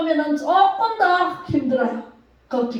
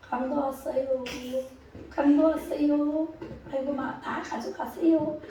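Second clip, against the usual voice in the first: feeble call; 9 dB quieter than usual. Shown as a demonstration. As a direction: report the robber with no urgency at all. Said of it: "이렇게 하면 강도가".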